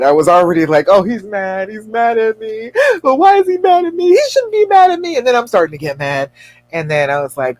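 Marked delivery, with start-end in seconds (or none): 0.9-5.2 retold panic climbing into a high squeal; mock fretting. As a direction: work yourself up, higher and higher. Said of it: "assuming that he was mad".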